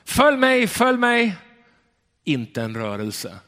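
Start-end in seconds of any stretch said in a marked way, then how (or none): 0.0-1.3 high, loud attempt at the shepherd's call; tourist imitating the call. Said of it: "och så ropade han". (turisten härmar ropet)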